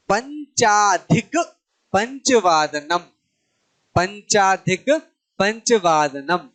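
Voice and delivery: slow, syllable by syllable; slow Sanskrit demo. Shown as a demonstration. Teacher spelling out Sanskrit time terms slowly for students. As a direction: spell each Sanskrit word out slowly for the class. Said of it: "तो पाँच को तो पंच बोलते हैं".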